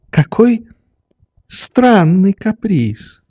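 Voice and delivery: put-on high, halting old man's voice; musing old doctor. A narrator's reading. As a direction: muse in puzzled, drawn-out fragments.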